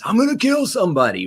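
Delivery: high-pitched mock threat; escalated anger. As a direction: imitate a man worked up out of control. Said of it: "so escalated because he was using these escalated words".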